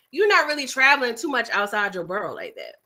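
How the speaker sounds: ordinary delivery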